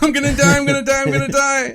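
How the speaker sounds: high, loud, terrified screaming; panicked scream. Pretend. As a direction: shriek it as the shark closes in. Panicking on sinking boat.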